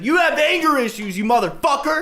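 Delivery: high, strained mock yell; retold screaming. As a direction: hurl it back as in a screaming match. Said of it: "because they're screaming".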